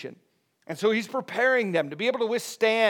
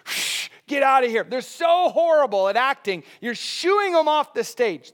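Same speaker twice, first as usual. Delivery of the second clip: higher and louder jeer at a bad actor; mock jeering shout; 7 semitones higher, 6 dB louder than usual. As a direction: shoo them off the stage. Crowd shooing bad actor.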